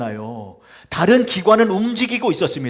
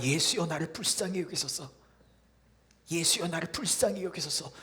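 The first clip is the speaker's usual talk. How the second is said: quiet cry; 10 dB quieter than usual. Acted. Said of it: "소리를 쳤는데".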